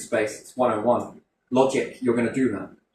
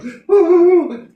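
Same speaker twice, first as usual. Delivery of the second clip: high shrieking laugh; 11 dB louder than usual. Real, not acted. Laughs after mock panic.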